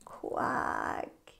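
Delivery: a soft, weary quack; a spent little quack; duck's tired quack. Acted. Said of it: "The duck answered".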